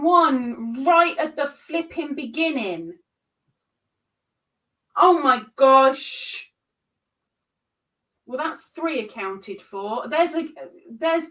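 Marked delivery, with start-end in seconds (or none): none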